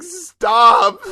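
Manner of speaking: pleading, slow and loud; mock plea. Real, not acted. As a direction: beg it to stop, half joking. Mock plea to stop the album.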